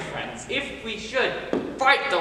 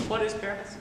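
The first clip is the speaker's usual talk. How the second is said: ordinary delivery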